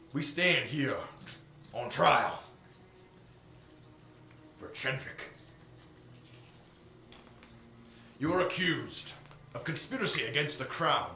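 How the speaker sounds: loud, stern courtroom admiral; formal admiral. Acted. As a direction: read the charges like a judge. Admiral reads charges.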